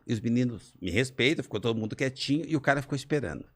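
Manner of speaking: normal talking voice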